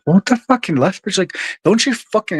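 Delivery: high-pitched incredulous retort; retold disbelief. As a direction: replay your exasperated disbelief, pitched right up. Retelling his disbelief about the office.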